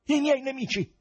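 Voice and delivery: very high, loud accusing cry; terrible voice. A narrator's reading. President blames his enemies to the investigators, terrible high cry. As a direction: cry out wildly in self-defence. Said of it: "con voce terribile".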